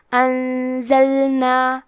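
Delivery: انزلنا with the نون hidden in a nasal hum before ز, slow; ikhfa with ghunna. Shown as a demonstration. Teacher shows the hidden nasal nun in انزلنا. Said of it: "نون ساکن کو چھپاتے ہوئے اور غنہ کے ساتھ".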